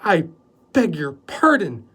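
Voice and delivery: slow, drawn-out fake offence; the houseboy feigning hurt pride, slow and loud; mock indignation. A narrator's reading. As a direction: play at being insulted. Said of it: "with mock indignation".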